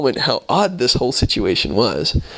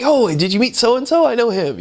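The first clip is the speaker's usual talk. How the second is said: high eager local voice; 11 semitones higher than usual. Retold or imitated. Locals ask about acquaintances.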